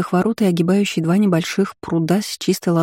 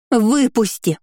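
higher, piercing shriek; shrill scream; 5 semitones higher, much slower than usual. A narrator's reading. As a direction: shriek it at the top. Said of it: "Голос девушки превратился в ультразвук".

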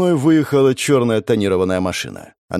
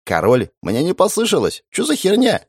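high, fast alarmed shout; panicked bandit yell; 9 semitones higher than usual. Acted.

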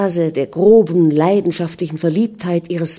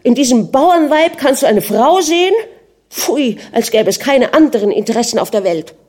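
much higher and louder, indignant; furious outburst; 6 semitones higher, 4 dB louder than usual. A narrator's reading. Anna outraged at her husband about the peasant woman, furious. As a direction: blaze with jealous scorn. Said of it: "sagte zornrot".

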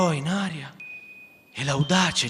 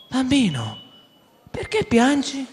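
put-on little girl voice; 10 semitones higher than usual. Jane asks crying boy.

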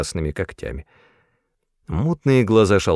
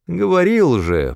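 high-pitched grumble; peeved, raised-pitch complaint; grumbling; 9 semitones higher, 3 dB louder than usual. A narrator's reading. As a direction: grumble an I-told-you-so. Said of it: "проворчал кот".

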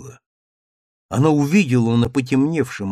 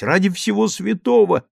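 pleading; 7 semitones higher than usual. A narrator's reading. Daughter begs.

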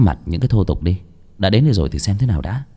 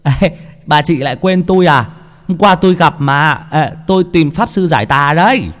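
put-on higher, cheerful young man; cheeky young man; 8 semitones higher, 3 dB louder than usual. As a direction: greet her like an old friend. Bể character voice. (giọng thanh niên cao, tươi tỉnh)

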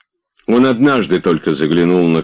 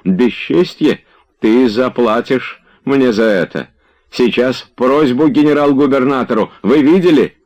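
higher, affronted voice, mounting; outraged threat; 6 semitones higher than usual. A narrator's reading. Slapped Tarantyev threatens a petition, outraged.

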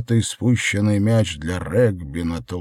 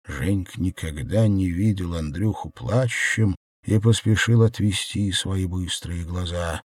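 plain reading voice

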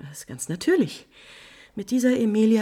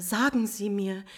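ordinary delivery

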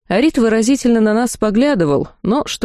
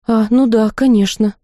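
ordinary delivery, nothing marked

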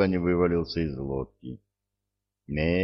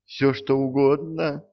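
high, louder panicked yes; trembling with fear; 9 semitones higher, 4 dB louder than usual. A narrator's reading. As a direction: gasp it out shaking. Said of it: "трясся консул".

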